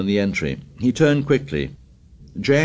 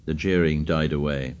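ordinary delivery, nothing marked

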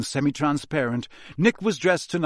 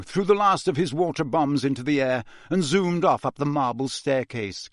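usual speaking voice

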